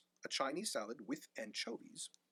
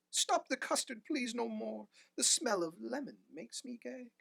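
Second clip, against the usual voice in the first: put-on high, pleading old man's cry; pitiful cry; 9 semitones higher than usual. A narrator's reading. Old man pleading with the police to stop firing. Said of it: "a pitiful cry".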